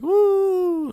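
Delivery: high, loud cheer; joyful whoop. Retold or imitated. Ron cheering that his sister Ginny is safe, high whoop. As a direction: let out a whoop of relief. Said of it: "Ron's like".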